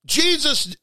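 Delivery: ordinary talk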